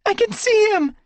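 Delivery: very high, loud excited cry. Acted. Squirrelpaw spotting Firestar under branches, loud cry. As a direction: cry out as you spot him. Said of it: "she cried".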